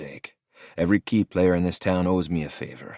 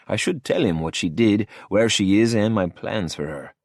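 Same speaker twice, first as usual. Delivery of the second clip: usual speaking voice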